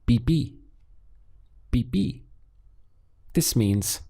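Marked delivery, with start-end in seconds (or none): 0.0-2.2 "bi bi." said as two short crisp syllables with a B; sound demo. Shown as a demonstration. Teacher isolates "bi bi." sound from viví for students, crisp demo. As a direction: isolate the sound "bi bi." cleanly for learners to copy. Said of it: "So the sound you get is".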